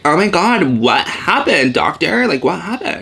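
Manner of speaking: high, loud gossipy disbelief; mock shock. Acted shocked reaction to sad news.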